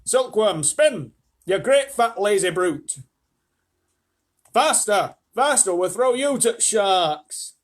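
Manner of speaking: loud, high commands with drawn-out ends; urgent shouted orders. A narrator's reading. Bossing the silkworm to spin, loud urgent shout. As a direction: shout threats to drive on a worker. Story character barking orders, threatening.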